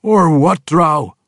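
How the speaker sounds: high, loud dwarf challenge; defiant demand. Acted. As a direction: square up to him. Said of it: "Rollo demanded".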